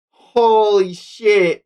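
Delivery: usual speaking voice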